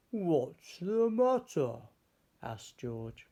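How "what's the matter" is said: gentle concern. Acted. George asks the sad giraffe.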